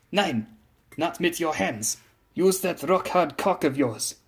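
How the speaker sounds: put-on high, slow German-accented instruction; deliberate, imperious German figure; German ship lady, commanding. A narrator's reading. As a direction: spell it out firmly in a German accent.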